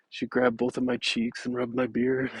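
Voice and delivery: ordinary talk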